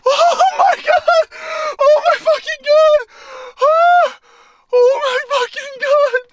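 high, loud shrieking with a laugh in it; ecstatic screaming. Real, not acted. Angler overwhelmed by his record fish, shrieking with joy. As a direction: yell out in pure disbelief.

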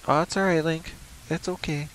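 high, cooing reassurance to a scared hero; mock comforting. Player comforts his game hero Link, high and cooing. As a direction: soothe him like a frightened child.

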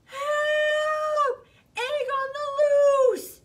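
very high, loud cry for help; panicked shout. Acted. Bear yelling for help as the egg rolls, panicked and loud. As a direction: scream it out like the egg is escaping. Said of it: "shouted Bear".

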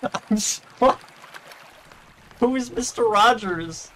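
high, slow baffled question; incredulous. Real, not acted. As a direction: drag it out in disbelief.

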